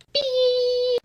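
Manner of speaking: high mock censor beep; a sharp mouth-made beep.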